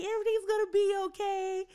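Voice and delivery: high, sing-song reassurance; mock-cheerful cake message to a crying girl; cheery comforting. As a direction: read the cake like a bright pep note.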